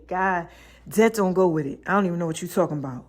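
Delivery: soft, low, fast muttered reply to God; half under the breath, brushing God off; hushed retort. Retold or imitated. Telling God she doesn't get it, quietly.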